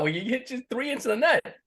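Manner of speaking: high imitated voice, answering matter-of-factly; mock dry retort. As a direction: play the partner pointing out the obvious. Imitated dry reply to the player.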